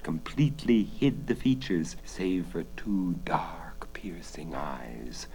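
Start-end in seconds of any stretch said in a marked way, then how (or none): 2.0-5.3 whispering. A narrator's reading. Suspense narration hushed.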